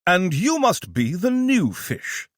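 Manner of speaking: high, slow, hammy British purr; creepy fake British. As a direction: draw it out, sly and theatrical. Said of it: "his eyebrows waggling in a creepy way".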